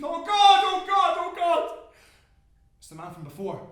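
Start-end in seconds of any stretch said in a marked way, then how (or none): 0.0-1.8 high, very loud terror; panicked screaming. Acted. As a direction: cry out in blind panic. Choking, panicking in smoke.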